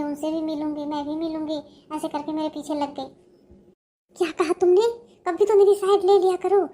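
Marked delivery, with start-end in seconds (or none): 4.2-4.9 louder, sharp challenge; indignant mother. Acted. Mother indignant at daughter's complaint. 5.3-6.6 higher, louder throwing back of her words; indignant echo. Acted. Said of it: "तू मुझे बोल रही है कि".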